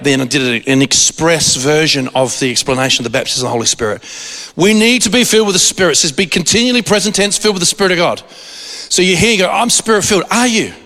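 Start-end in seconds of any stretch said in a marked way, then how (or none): 4.5-10.7 high, rising sermon delivery; preaching. Acted. Preacher urging the congregation to be filled, fervent. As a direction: preach it with fire to the crowd.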